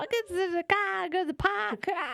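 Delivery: high hammed-up Boston accent. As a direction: laugh through a Boston accent.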